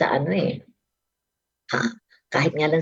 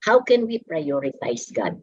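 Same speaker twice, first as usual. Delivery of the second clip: normal talking voice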